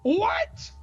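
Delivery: high, rising incredulous yelp; shocked. Acted. Hearing an outrageous proposition, shocked. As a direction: shoot the question right up in disbelief. Creature's offer shocks listener.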